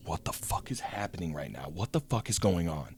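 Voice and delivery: low, very soft bewildered mutter; hushed alarm. Retold or imitated. Ducked in the car, wondering at the yelling, hushed. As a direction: ask it under your breath, keeping hidden.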